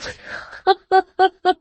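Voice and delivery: high staccato ha-ha-ha; mocking ruffian's cackle; villain's laugh. A narrator's reading. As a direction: laugh at her in a run of high, separate bursts. Hugh laughs at the girl.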